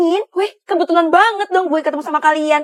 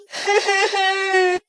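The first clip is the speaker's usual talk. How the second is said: over-the-top crying burst; 4 semitones higher, 4 dB quieter than usual. Acted. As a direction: wail like a mother over spilled chili. Mother crying over chili.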